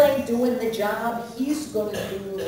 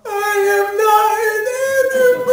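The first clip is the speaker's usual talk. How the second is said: high, loud hammed-up cry of I am not an animal; mock Elephant Man yell; 12 semitones higher, 8 dB louder than usual. Comic to heckler, mimicking a desperate high yell. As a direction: bellow it like a tormented movie man. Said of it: "I don't have to yell".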